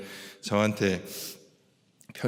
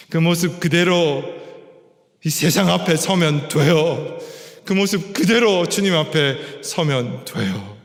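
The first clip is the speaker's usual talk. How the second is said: loud, raised preaching appeal; impassioned preaching; 4 semitones higher, 3 dB louder than usual. Real, not acted. Preacher urging the congregation to stand as they are, impassioned. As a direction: proclaim it firmly to the whole room.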